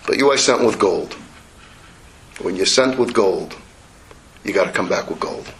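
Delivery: apologize, then teach tenderly; gentle apology. Acted. Father apologizes to his son and explains gently.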